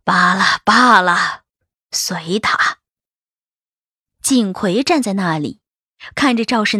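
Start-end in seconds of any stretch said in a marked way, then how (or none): 0.0-2.8 put-on dismissive old matriarch. A narrator's reading. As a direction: wave it away, then let it drop. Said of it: "一摆手道".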